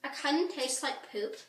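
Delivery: talking while eating; chewing candy. Real, not acted. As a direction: mouth partly full. Seen 1: Both hold candy at mouths; chewing likely, speaker unclear.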